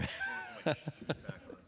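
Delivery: soft chuckle to oneself; quiet, amused laughing. Real, not acted. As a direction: laugh softly after an apology. Laughs after calling someone up.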